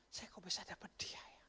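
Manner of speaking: whispered in grateful wonder; awed whisper. Retold or imitated. Preacher voices a grateful wife, whispered. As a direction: breathe it like a secret thank-you.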